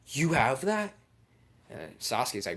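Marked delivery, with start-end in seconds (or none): 0.1-0.9 high, loud, incredulous voice; astonished question. Acted. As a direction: ask it in hammed-up astonishment. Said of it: "He's like".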